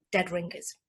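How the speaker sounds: ordinary talk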